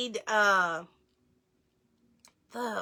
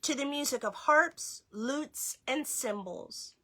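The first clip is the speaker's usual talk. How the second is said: lively read-aloud, slightly higher; reading to children; 4 semitones higher than usual. Acted. Parent reading a Hanukkah book to children, lively read-aloud. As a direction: read the book brightly to the kids.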